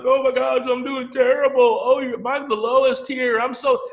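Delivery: high, worried mock whine; panicky whiny voice. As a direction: imitate someone fretting dramatically. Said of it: "there were a few people like".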